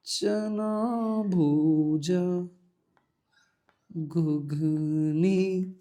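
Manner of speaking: very slow, stretched food names; slow mock-longing drawl; teasing drawl. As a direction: tease by drawing out each snack. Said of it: "teasing about snacks".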